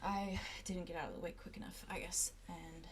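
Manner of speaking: soft, resigned admission; quiet grim confession. Acted. Silas telling Arlo he may be dying, quiet and resigned. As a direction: let it sink heavy.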